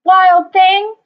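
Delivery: loud scolding call of a mother. Acted. Mother scolding Max, a loud high call. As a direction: shout it at the naughty boy. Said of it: "His mother called him".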